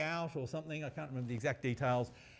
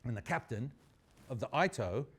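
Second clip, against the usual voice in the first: ordinary talk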